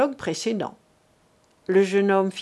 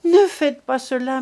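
a higher, louder plea to the officers; mother cries out to the officers, desperate; desperate cry; 4 semitones higher, 6 dB louder than usual. Acted. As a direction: cry out to stop them. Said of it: "s'écria-t-elle".

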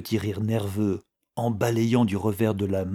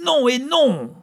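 high, loud defiant cry; 7 dB louder, slower than usual. A narrator's reading. Chouchou beaten but resisting, high loud cry. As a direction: shriek your refusal. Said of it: "Elle cria".

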